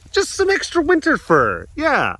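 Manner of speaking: very high squeaky cooing. Real, not acted. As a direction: squeal it fondly to a pet. Baby-talks a pet about fur.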